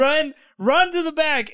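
high, loud mock urging; urgent calling. Partygoers fleeing the cops to the back, mock urgent shout. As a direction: imitate someone hurrying people away.

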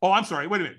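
ordinary delivery

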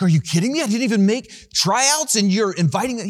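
high, loud, thrilled disbelief; can't believe his luck on the phone; a kid getting the call he dreamed of, thrilled and loud; excited disbelief. Retold or imitated. Stunned boy on phone call.

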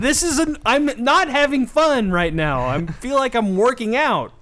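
mock-distressed whining outburst; miserable complaint. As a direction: protest in hammed-up misery. Acting miserable dancer's complaint.

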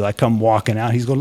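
usual speaking voice

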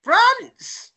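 high-pitched cry; obliging shout. Acted. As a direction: shout the word on demand. Badger obeys the tramp's demand.

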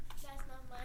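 unvoiced whisper of the refusal; whispered rebuke. Shown as a demonstration. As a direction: breathe it out without voice. Whispered rebuke during throwing demo.

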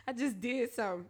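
normal talking voice

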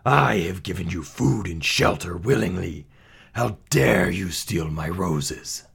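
rough, growling reproach; an outraged monster rebuking a thief; snarling beast. A narrator's reading. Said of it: "he snarled".

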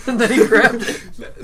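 high laughing repeat. Real, not acted. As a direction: laugh through the words.

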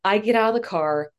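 ordinary delivery

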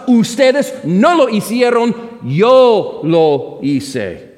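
grita "ustedes no lo hicieron", luego "yo lo hice" muy lento; énfasis predicador. Acted. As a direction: golpea el contraste entre ustedes y yo. Contraste enfático en la predicación. (el predicador grita y luego recalca despacio "yo lo hice")

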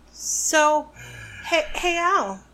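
high, bright call to wake someone; chirpy hey to a sleepy friend; perky call-out. Real, not acted. Waking up co-host.

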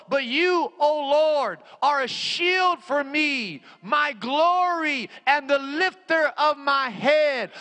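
high, slow, ringing proclamation; impassioned scripture cry. Real, not acted. Preacher hurls the Psalm at the devil, impassioned scripture cry. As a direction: cry the verse out as a battle call. Same verse repeated at full force.

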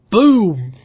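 loud, punchy boom; excited boom. Acted. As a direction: punch out the explosion. Narrating an explosion in the match.

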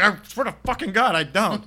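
high loud desperate denial; mock panicked denial. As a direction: swear it like you're being roughed up. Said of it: "It's like".

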